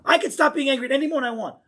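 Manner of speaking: high, loud and fast defiance; mock angry boast. Angry person insisting he can stop anytime, fast and loud. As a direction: rattle off the claim defiantly.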